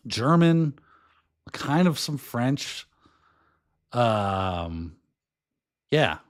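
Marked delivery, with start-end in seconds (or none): none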